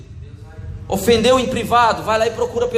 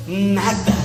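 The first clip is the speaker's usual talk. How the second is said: sulky. Acted. Sulking partner insisting nothing is wrong, sulky. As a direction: refuse to open up, acting hurt. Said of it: "que você tá emburrada".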